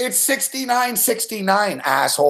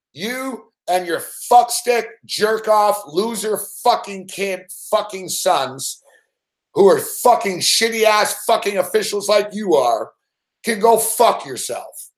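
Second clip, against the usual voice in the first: each insult spaced out slowly; slow furious rant; slower than usual. Real, not acted. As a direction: spit it out slowly with contempt. Ranting at ref, slow.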